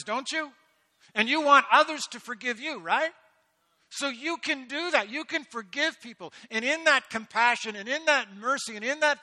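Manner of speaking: fiery sermon peak; full-force preaching. Real, not acted. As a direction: preach it hard to the room.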